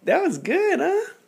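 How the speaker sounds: very high cooing praise; high baby-talk voice. Acted.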